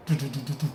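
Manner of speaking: rapid staccato gunfire noise; imitated machine gun. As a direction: rattle off toy machine-gun fire.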